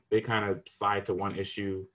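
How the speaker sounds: ordinary talk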